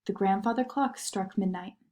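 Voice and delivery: ordinary delivery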